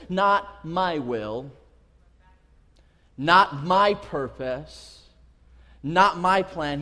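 slow, weighty, separated phrases; solemn submission. Acted. Jesus yielding to his Father's will, solemn. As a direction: set down each phrase with long pauses.

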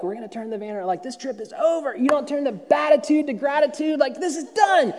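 hammed-up stressed mum laying down the law; mock parent threat. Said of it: "raining down threats on my children".

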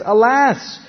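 high, loud cry of alarm; a boy seeing the army at dawn, crying out in panic; panicked cry. Retold or imitated. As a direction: cry it out in sudden fear. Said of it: "he immediately panics".

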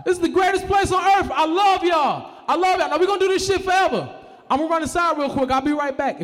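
very high, loud overjoyed shouting; happy chicken voice. Acted. Chicken in a field shouting its joy to friends, overjoyed. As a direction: shout like a thrilled carefree chicken. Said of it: "chickens in the field like".

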